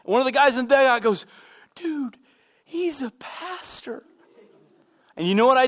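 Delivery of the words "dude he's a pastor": high, soft, slow teammate imitation; mock discreet warning to a buddy; softly pitched-up cautioning; hushed warning. Teammate warning the cursing player, retold.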